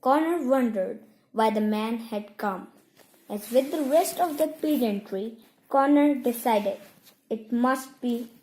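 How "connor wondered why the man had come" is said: usual speaking voice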